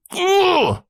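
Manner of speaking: squeaky disgusted groan; sudden high grunt of disgust; high disgusted ugh. Real, not acted. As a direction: let out a high frustrated ugh.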